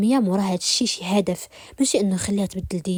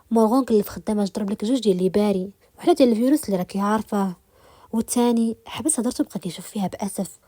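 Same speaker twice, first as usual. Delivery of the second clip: ordinary delivery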